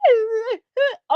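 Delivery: short burst of laughter. Real, not acted. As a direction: break into a quick laugh. Laughs mid-advice.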